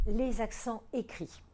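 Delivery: normal talking voice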